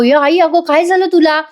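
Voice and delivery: high, worried question; a touch of panic; mock alarm. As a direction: imitate a startled son. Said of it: "Parth घाबरतो".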